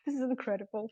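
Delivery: usual speaking voice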